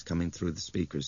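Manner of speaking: ordinary delivery, nothing marked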